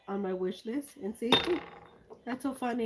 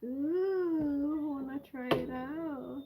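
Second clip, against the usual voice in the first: delighted coo; 5 semitones higher, slower than usual. Real, not acted. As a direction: coo over a treat you can't wait to try. Delighted at the new paint.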